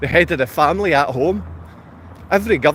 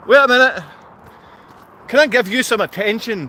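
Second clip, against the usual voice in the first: normal talking voice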